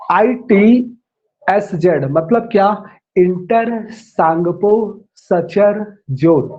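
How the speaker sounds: each word drawn out for note-taking; slow dictation. Shown as a demonstration. Teacher dictating the name to students, slow and spaced. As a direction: dictate it slowly so they can write. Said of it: "फिर से आप इसका नाम लिखिए".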